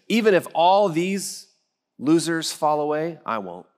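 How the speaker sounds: Peter's cocky vow, loud at first, dropping at the end; prideful boast. Acted. Peter vowing loyalty above the others, prideful. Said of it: "does that sound just a little bit prideful".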